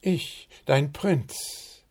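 high and slow, grandly; bow while you say it; gallant prince. A narrator's reading. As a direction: bird prince introducing himself elegantly. Prince presents himself.

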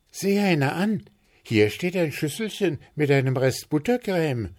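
high-pitched delighted call. A narrator's reading. Zwitschi finding leftover buttercream, calling out. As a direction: call out a tasty find in a high bird voice. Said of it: "rief Zwitschi".

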